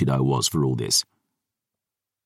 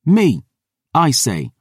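put-on higher, defiant young woman; 8 semitones higher, 3 dB louder, slower than usual. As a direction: play the stubborn girl. Dior's girl voice.

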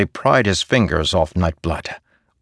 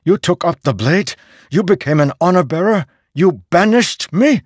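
rising, accusing demand, higher and louder; 11 semitones higher, 5 dB louder than usual. A narrator's reading. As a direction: hurl each question harder. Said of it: "Szeth demanded".

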